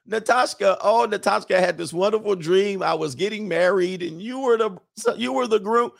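high, dreamy mock foreign girlfriend accent; Natasha's accent. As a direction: gush in her high-pitched imitated accent. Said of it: "in her accent".

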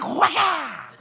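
loud whack sound; imitated blow; mock whack. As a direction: bark out the machete chop. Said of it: "he was like".

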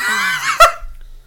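high burst of laughter; laughing. Real, not acted.

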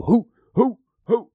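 high hooting to scare off a bear; shooing hoots. Shown as a demonstration. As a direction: hoot high and sharp to shoo them. Demonstrates shooing hoot.

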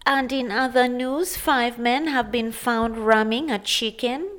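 slow, measured put-on newsreader voice; mock news bulletin with drawn-out phrasing; radio news anchor. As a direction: imitate a radio anchor reading the bulletin, unhurried. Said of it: "News anchors on radio stations are always like".

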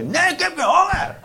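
very high, shrieked tantrum refusal; screaming child. Acted. Hungry child refusing a parent's suggestion, screaming. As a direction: squeal it like a child in denial.

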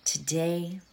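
fading to a hushed whisper; soft whisper. Acted. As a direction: let the mantra drift into a whisper. Meditation fades into whispered mantra.

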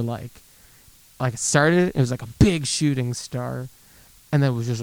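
ordinary talk

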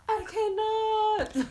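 very high, stretched-out wail of disbelief; mock exasperation. Real, not acted. Co-host reacting to her friend's rant, high drawn-out mock wail. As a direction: draw it out high and slow.

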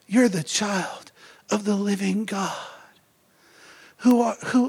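ordinary talk

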